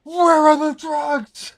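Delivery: very high, loud, slow squeal; piercing squeak. Pretend. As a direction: shriek the name slowly. High shouted silly voice.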